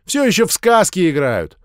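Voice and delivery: put-on a kid sneering at little ones' games; loud contempt. A narrator's reading. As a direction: snort it dismissively. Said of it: "громко презрительно фыркнул".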